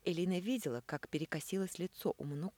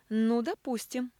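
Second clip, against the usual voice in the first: smirking; 8 semitones higher, 9 dB louder, slower than usual. A narrator's reading. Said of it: "усмехнулся Мануков".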